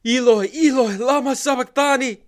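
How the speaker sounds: a loud, high anguished cry; full-voiced howl of abandonment. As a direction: cry it out to heaven in agony. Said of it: "Jesus cries out".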